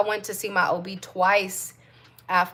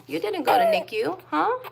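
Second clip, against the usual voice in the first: much higher, rising sing-song question; 11 semitones higher than usual. Real, not acted. Mom cooing to her newborn.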